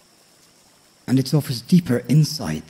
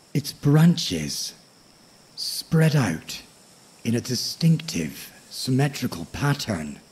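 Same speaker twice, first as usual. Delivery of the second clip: nature documentary. A narrator's reading. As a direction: lull the listener toward sleep. Sleep story narration of tree.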